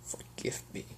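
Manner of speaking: very soft breathy forgive me; broken whisper. Acted. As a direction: barely voice it. Broken husband begs forgiveness.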